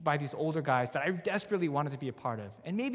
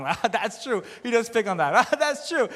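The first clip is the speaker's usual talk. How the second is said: high, loud, imitated laughing agreement; laughing along; 6 semitones higher, 8 dB louder than usual. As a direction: laugh through it, re-enacting naive amusement. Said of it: "I was, like, laughing".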